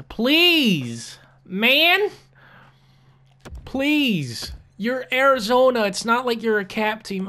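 high, loud desperate begging; whiny pleading. Real, not acted. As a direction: plead with the other GM, voice cracking upward. Begging the AI to accept trade.

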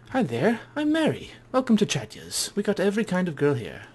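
high, chirpy put-on girl's voice; high-voiced hostess introducing herself; friendly brothel girl.

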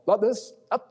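ordinary talk

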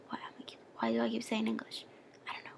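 hushed, half-whispered self-reproach; quick, barely voiced aside; muttering to self. Real, not acted. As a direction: mutter it under your breath at your own slip. Muttering over own slip of the tongue.

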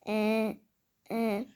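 ordinary delivery

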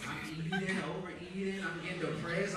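ordinary talk

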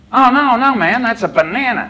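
put-on high, exclaiming American accent. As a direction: correct him in a high American voice, banana with short "a".

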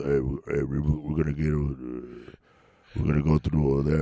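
very low, monotone grumble; Vin Diesel. Acted. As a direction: rumble it way down low, mumbling. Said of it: "like a Vin Diesel grumbling at you".

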